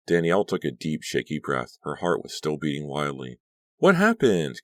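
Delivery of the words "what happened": alarmed question. A narrator's reading. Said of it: "Caroline asked".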